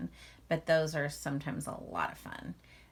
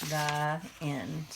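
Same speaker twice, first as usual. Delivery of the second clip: lively, expressive read-aloud to a class; reading to children; 3 semitones higher, 4 dB louder than usual. A narrator's reading. Teacher reading a picture book to the class, lively read-aloud. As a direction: draw young listeners into the story.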